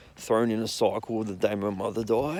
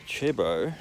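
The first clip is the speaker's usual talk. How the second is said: normal talking voice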